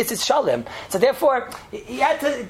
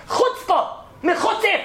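high-pitched indignant exclamation; mock outrage; 12 semitones higher, slower than usual. Students outraged at the woman spitting, high mock indignation. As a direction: cry it out high, hammed-up indignation.

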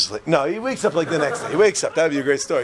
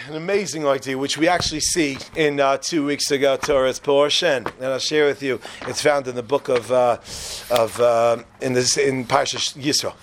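usual speaking voice

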